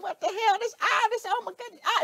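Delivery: high, startled squeal of disbelief; shocked squeal. Acted. Hanging in the air mid-wedgie, squealing in shock. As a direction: yelp it out like you've just been yanked off your feet. Acting out getting an atomic wedgie.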